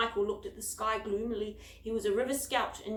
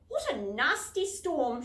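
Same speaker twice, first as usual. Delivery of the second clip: cheerful; 5 semitones higher, 5 dB louder, slower than usual. A narrator's reading. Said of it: "she said cheerfully".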